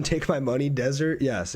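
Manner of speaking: normal talking voice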